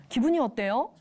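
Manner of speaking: normal talking voice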